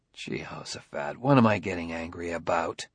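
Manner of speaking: low, hushed self-questioning; inner thought. A narrator's reading. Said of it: "he thought".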